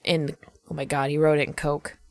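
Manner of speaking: normal talking voice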